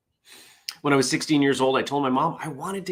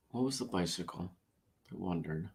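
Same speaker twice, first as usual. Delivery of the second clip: much softer, muttering it to himself; muttered reread; 4 semitones lower, 13 dB quieter than usual. Real, not acted. Vlogger rereading the joke to himself, muttering. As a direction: mutter the line while checking it.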